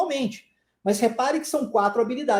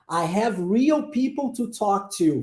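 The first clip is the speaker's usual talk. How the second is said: slow model sentence; slower than usual. Shown as a demonstration. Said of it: "Vamos tentar essa frase agora?".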